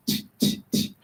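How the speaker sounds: unvoiced clock ticks; whispered ticking t. Shown as a demonstration. Teacher imitating the clock's ticking, whispered t's. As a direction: tick out the t's in a breathy whisper. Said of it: "what also goes t".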